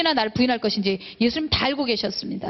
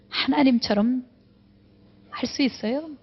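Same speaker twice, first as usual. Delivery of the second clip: ordinary delivery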